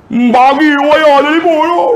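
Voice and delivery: high mock child demand. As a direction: demand the phone like a spoilt boy.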